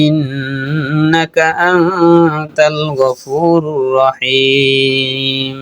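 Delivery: very drawn-out melodic chant; flowing, unhurried chant with long held endings; Arabic prayer recitation. Shown as a demonstration. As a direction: recite slowly, stretching the long vowels and nasals.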